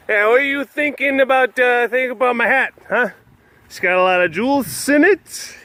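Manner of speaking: high, silly fake Queen voice; deliberately awful Queen of England; bad Queen impression. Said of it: "that is not what the Queen sounds like".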